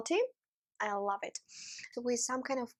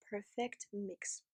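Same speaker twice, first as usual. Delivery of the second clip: soft breathy whisper; 3 semitones lower, 13 dB quieter, slower than usual. Real, not acted. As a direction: hush it like a secret. Whispered aside while tasting.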